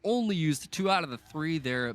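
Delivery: loud, high-pitched burst of play-by-play awe; excited amazement. Real, not acted. Caster to co-caster after a stunning leap, excited amazement. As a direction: marvel out loud at a stunning move.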